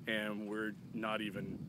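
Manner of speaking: ordinary delivery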